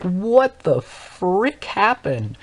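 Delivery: slow, drawn-out, high stunned question; stunned disbelief. Retold or imitated. Watching a classmate storm out, stunned and slow. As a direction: let the shock stretch every word. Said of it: "I was just sitting there stunned".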